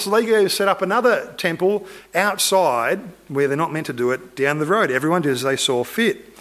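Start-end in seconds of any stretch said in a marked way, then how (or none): none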